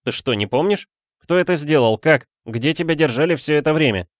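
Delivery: lightly voiced agitated student; exclaiming. A narrator's reading. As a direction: fire off urgent questions. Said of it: "воскликнул студент".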